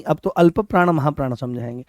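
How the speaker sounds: ordinary delivery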